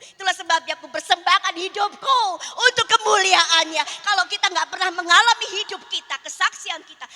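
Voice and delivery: loud, high, triumphant preaching; full-force preaching. Acted. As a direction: proclaim the miracle to the congregation. Preaching climax. (khotbah berapi-api dengan suara keras)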